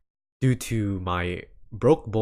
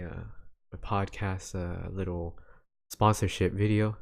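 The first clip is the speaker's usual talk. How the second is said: ordinary delivery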